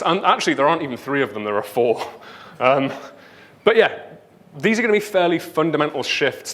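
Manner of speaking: normal talking voice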